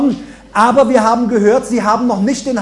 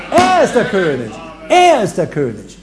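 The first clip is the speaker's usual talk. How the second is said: ER stressed, falling firm; preacher's proclamation; proclaiming the king; 3 semitones lower than usual. Acted. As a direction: declare it twice with weight. Preaching climax, repeated proclamation.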